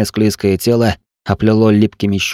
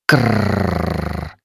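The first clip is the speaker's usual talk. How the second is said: creaking Kraken; 3 dB quieter than usual. Acted. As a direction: creak like a huge beast opening its beak. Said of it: "снова заскрипел Кракен".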